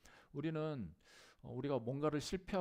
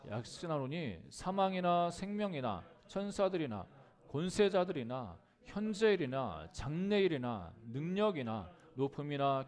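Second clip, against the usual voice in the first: loud, raised-pitch Bible recitation; scripture reading; 3 semitones higher, 5 dB louder than usual. Acted. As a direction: lead the congregation through the verse in a sustained, projected voice. Leads congregation in reading scripture.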